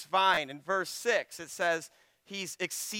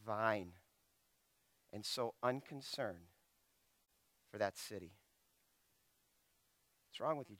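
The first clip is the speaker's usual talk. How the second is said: much softer, lower, intense pleading; hushed rebuke; 6 semitones lower, 11 dB quieter than usual. Acted. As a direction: drop low and press the question. God voiced, hushed intense rebuke.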